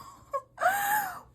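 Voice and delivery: high shrieking disbelieving laugh; a squealing shocked laugh. Real, not acted. Shocked by the confession, a high shrieking laugh.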